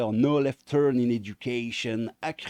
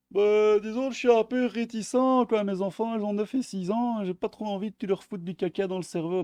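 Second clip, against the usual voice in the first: much higher put-on voice of a fussy parent; mocking parent voice; 11 semitones higher, 3 dB louder than usual. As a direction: squeak it out like a prissy dad. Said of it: "Storm. Storm Neverson, fait évidemment".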